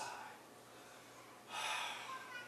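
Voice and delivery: long breathy sigh of giving up; helpless sigh. Shown as a demonstration. Teacher showing the girl's sigh, helpless sigh. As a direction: let the air out, nothing to be done. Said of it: "Etsuko叫I gave a sigh".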